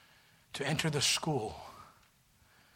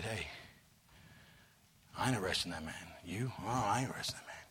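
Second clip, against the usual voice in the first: softer, lower, whispered aside between officers; hushed officers' whisper; 4 semitones lower, 8 dB quieter than usual. Acted. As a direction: whisper it like guards afraid to be overheard. Officers whisper refusing to arrest.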